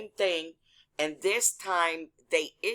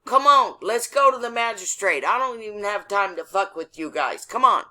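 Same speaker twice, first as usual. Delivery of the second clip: raised, impatient retold yell; yelling out the window; 4 semitones higher, 5 dB louder than usual. Driver yelling out the window at three cops, impatient. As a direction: call out to hurry them up, high and loud at first. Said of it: "Now I start yelling out the window".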